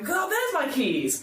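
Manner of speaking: high, drawn-out exclamation; mock sudden realisation. Townsfolk remembering after getting memories back, comic realisation. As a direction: exclaim it like a sudden happy discovery.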